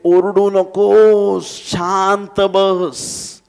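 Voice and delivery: high, loud, drawn-out command to hush; scolding order. Acted. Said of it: "जे त्याला म्हणत होते".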